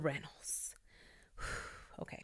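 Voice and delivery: unvoiced, secretive whisper; hushed aside about the couple. Real, not acted.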